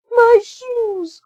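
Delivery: high, loud call. A narrator's reading. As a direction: call it out sharply. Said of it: "called Anastasia".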